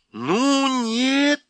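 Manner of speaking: high, loud cry; loud protest. A narrator's reading. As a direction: shout over the noise. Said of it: "громко сказала Люси". (громко, перекрикивая шум)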